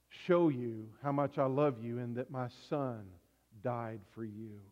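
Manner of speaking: hushed heartfelt pastor; intimate soft preaching. Acted. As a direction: draw them close and speak gently of mercy.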